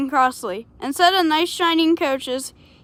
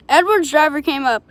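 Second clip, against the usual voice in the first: ordinary delivery, nothing marked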